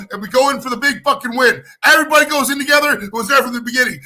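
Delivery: usual speaking voice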